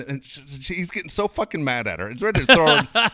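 ordinary talk, nothing marked

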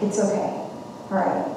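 very soft, retold comforting; soothing reassurance. Two friends decompressing after the kids' bedtime, soothing. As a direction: lightly imitate a friend's quiet consolation.

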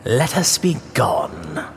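mock the plea back, coldly and slowly; cold echo. A narrator's reading. Montresor echoes coldly.

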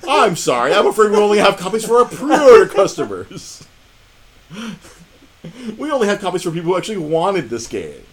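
imitating a gloating clerk, loud and high; gleeful mock apology. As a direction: announce the refusal loudly, savoring the torture. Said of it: "The glee that this man had".

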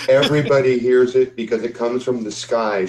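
usual speaking voice

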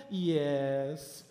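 low, drawn-out gentle imitation; Pastor Timothy. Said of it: "he's so gentle when he talks".

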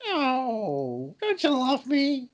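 high, wounded put-on whine; mock hurt silly voice. Comic begging his cohost for love, in a high hurt whine. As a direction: fake heartbreak in a silly voice. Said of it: "your bloody voice".

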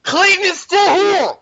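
high, loud, slow scream; shrieking that he is still around. Real, not acted. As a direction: yell it out at the top of your lungs. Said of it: "Talk about a screamer".

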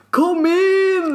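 laughing mock shout of come in. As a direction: call it out carelessly from across the flat. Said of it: "Inte så här".

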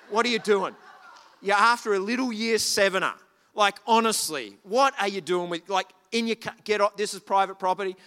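loud, scolding; a loud, fed-up telling-off of older boys; retold angry telling-off. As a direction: tell the boys off like a teacher. Confronting boys at her car.

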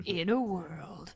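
slow, mock-epic trailer narrator; dramatic trailer announcer send-up; movie trailer voice. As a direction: parody a film trailer, drawn out. Movie trailer catchphrase parody.

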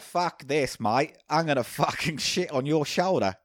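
drawn-out Aussie koala voice; Australian accent. Acted. Podcaster outdoing co-host as the koala, Australian accent. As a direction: swear slowly like a mock Australian. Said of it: "jealous of your Australian accent".

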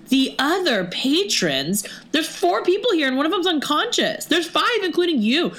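high-pitched, incredulous comeback; indignant retort. Retold or imitated. Customer retorting to bartender who kicked them out, indignant.

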